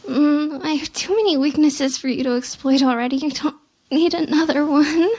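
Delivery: usual speaking voice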